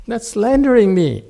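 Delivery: high, loud hammed-up protest of innocence; mock indignation. Master playfully protests being slandered, mock offended. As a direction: act wounded and outraged, for laughs.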